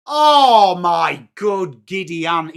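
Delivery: loud gasp then slow stretched phrase; drawn-out disbelief. Real, not acted. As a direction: drag it out at the miss. Disbelief at a missed goal.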